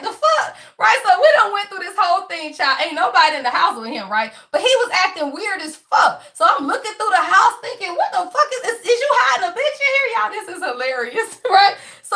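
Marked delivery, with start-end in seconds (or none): 0.0-0.5 high, laughing shocked squeak; incredulous high-pitched the fuck. Retold or imitated. Retold shock at his answer. 8.0-10.1 high, fast, accusing; much higher mock-jealous interrogation; suspicious. Retold suspicion searching his house.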